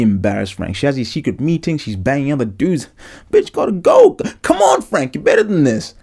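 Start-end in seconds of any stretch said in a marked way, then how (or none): 3.3-5.9 mock yell at the screen. Yelling at TV character Frank.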